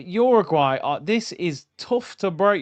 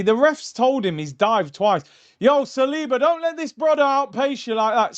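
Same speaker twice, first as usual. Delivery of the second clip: ordinary talk, nothing marked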